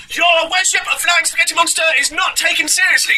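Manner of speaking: high-pitched mock preacher shout; Preacher Adam. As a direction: rail in a squealing preacher caricature. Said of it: "He says this, Preacher Adam".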